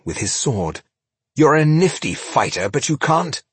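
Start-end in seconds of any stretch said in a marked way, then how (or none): 1.4-3.4 cocky jibe. Acted. Rodario jibes mid-fight.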